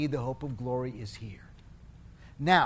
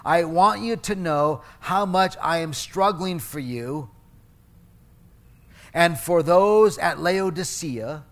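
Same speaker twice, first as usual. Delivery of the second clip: loud, slow, emphatic Bible reading; scripture reading; 6 dB louder than usual. Acted. Pastor reading Paul's letter to the church, emphatic scripture reading. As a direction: read the apostle's words out firmly and deliberately. Reads scripture aloud to congregation.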